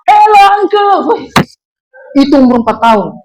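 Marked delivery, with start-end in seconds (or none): 0.1-1.2 put-on high, slow shouted child voice; small child greeting. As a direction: greet like a thrilled little boy. Child greeting uncle loudly. (suara anak kecil berteriak pelan dan tinggi)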